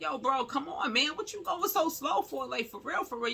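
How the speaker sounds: high-pitched, exasperated retold plea; urgent complaint. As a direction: hurry him along, voice pitched well up. Retold pleading with slow cab driver.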